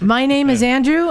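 ordinary talk

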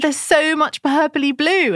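delighted, amused wonder; amused retelling. Retold or imitated. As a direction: marvel at it with a giggle in the voice. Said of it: "And I laughed".

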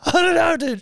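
very high, helpless wail; mock tearful whine. Husband answering his scolding missus, mock tearful whine.

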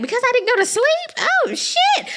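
high squeaky kid in mock shock. Imagined son reacting to being called bad, high and shocked. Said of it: "He's gonna be like".

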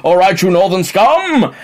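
a light posh British voice for a Geordie; lightly voiced toff posing as a northerner; posh English voice. Sketch Geordie in a light posh voice. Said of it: "they're just talking posh".

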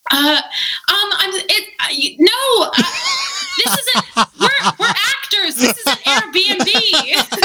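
high, laughing, stammering panic; nervous squeaky explanation, slowing at the end; flustered Dio. Retold or imitated. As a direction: stumble over it in a high, flustered voice. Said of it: "she's like".

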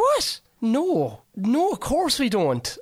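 high-pitched imitation of incredulous kids; mock disbelief. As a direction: squeal the what, then draw out the refusal. Retells kids' reaction to quitting question.

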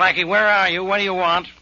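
high, brusque questioning; irritated demand. Acted. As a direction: demand answers from a troublesome caller. Inspector irritated by Blackie's phone call.

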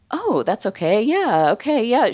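breezily accommodating. Retold or imitated. Matt answers a caller about her mother, breezily accommodating. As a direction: imitate an obliging man waving off a worry.

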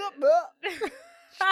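a high loud laugh. Real, not acted. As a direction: burst out laughing. Laughing at friend's fit.

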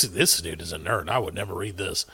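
low, soft mocking aside; muttered aside. Real, not acted. Host quietly mocking the book's author. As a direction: drop low and soft to mock.

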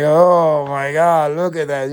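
lightly voiced shocked announcer; Vince impression. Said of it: "Vince was like".

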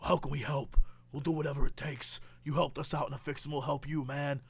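low, soft movie-tough voice; tough-guy loyalty. Acted. Old friends offer Nick Cage help, low hushed tough talk.

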